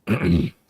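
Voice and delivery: loud rough throat clear. Real, not acted. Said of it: "gosh, excuse me".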